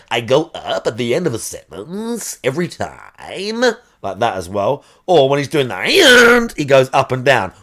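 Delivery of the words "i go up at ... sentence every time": light imitation of a Valley Girl rising at the end; mock uptalk, lifting the ends. Mimicking a streamer's Valley Girl uptalk, lightly. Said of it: "He does the Valley Girl thing".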